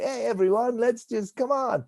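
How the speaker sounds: high-pitched party host rallying everyone; jovial host. Retold or imitated. Show host to the crowd at a house party, jovial and high-pitched.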